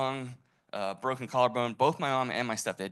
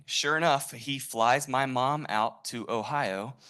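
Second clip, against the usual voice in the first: ordinary delivery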